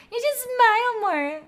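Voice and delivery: an odd high alien twisting the words; mock alien voice. Streamer reading the smile line in a laughing alien voice. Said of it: "exactly like that".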